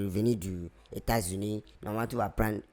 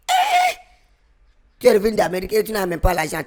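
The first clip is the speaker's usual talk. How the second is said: loud mocking outburst; 8 semitones higher, 7 dB louder than usual. Mocking the fake American.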